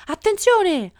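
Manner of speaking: high, loud alarm; sudden warning shout. A narrator's reading. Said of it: "gridò ad un tratto l'avvocato".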